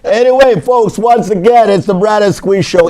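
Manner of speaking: excited. Real, not acted. Host relaunches show after laughter.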